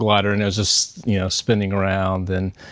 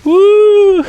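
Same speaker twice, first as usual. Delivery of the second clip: excited whoop; 11 dB louder than usual. Real, not acted. Cheer as the wing spins.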